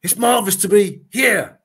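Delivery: high, loud showman's greeting; loud stage greeting. Retold or imitated. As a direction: hail the whole crowd, sidestepping the dispute. Greeting crowd, dodging the name dispute.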